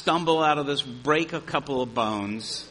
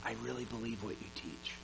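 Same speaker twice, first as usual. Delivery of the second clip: private quiet confession; 4 semitones lower, 14 dB quieter than usual. Retold or imitated. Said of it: "come to you sort of privately and quietly".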